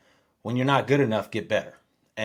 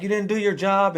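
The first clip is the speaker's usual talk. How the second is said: high-pitched retold rebuke from the coach; the coach scolding the player, voiced high; imitated coach scolding; 9 semitones higher, 3 dB louder than usual. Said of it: "And he's like".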